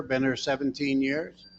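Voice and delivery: ordinary delivery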